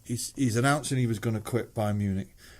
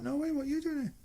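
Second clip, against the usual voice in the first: surprised greeting; 6 dB quieter, faster than usual. Retold or imitated. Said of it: "And he's like".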